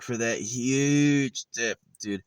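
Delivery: high, slow, drawn-out mock gratitude; sarcastic thanks. Chef mocking a five dollar tip, slow sarcastic drawl. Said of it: "he's like".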